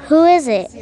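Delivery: Granny calling out to the door; called out. Acted. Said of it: "called out Granny".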